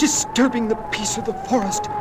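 loud, high hiss; sharp hissed reproach. A narrator's reading. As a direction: hiss it like an angry elm. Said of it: "hissed an elm".

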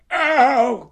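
high, loud ow; yelp of pain. A narrator's reading. Pooh's paw pulled by Rabbit, pained.

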